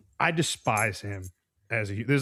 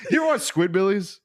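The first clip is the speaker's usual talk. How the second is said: laughing; 5 dB louder than usual. Real, not acted. Still in mock voice laughing.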